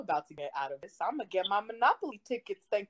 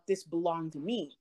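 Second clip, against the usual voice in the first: usual speaking voice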